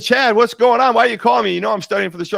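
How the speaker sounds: loud, high and impatient, telling him off; like being woken too early and annoyed; exasperated scolding. Retold or imitated. Scolding Chad on the phone about the early call, loud and exasperated.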